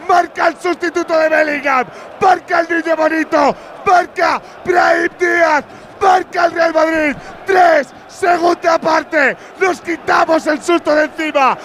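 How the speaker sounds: shouted slow goal recap; breakneck goal commentary. Real, not acted. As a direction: call the replay with fire. Shouted goal replay narration.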